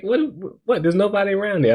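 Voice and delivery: high-pitched imitation of a baffled friend; mock disbelief. Acts out the friend's baffled reply.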